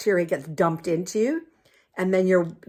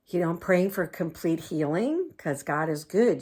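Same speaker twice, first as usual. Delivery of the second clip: usual speaking voice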